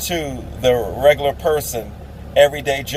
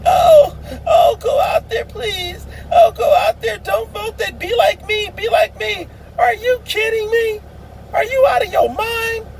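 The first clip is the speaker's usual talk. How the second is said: much higher, hammed-up sobbing plea; mock weepy actor. Mocking actors begging people to vote, hammed-up high sobbing. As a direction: ham up a tearful begging celebrity, way up high. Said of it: "stupid actors and actresses that are saying".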